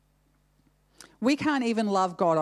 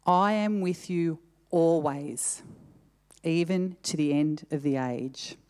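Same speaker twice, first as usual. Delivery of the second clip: "always" set apart and drawn out slowly; slow down into "always", then let the ending fall; stress on ALWAYS; 3 semitones lower than usual. Shown as a demonstration. Preacher gives the last reading of the verse, stress on "always". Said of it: "put an emphasis on a different word".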